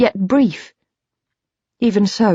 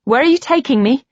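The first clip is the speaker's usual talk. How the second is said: higher and faster with rising panic; anxious, high; 6 semitones higher, 4 dB louder, faster than usual. A narrator's reading. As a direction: blurt it out, scared. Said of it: "my voice high and anxious".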